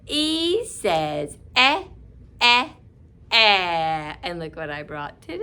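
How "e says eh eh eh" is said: "eh" stretched slow, last one louder; slow eh drill. Shown as a demonstration. Teacher leading children through "eh", slow. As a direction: say "eh" slowly with the class. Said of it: "You do it with me".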